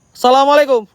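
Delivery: loud high call into the dark; greeting shouted across the graves. Real, not acted. Calling out at cemetery.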